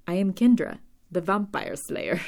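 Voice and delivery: hammed-up bad accent, proudly announced; Kendra's terrible accent. Kendra introducing herself as slayer, in a badly faked accent. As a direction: do a deliberately terrible imitation of her accent. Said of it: "my terrible imitation of her terrible accent".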